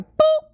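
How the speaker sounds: squeaky high boop; mock cartoon poke noise; playful boop. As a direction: poke her with a tiny high boop sound. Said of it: "I was like".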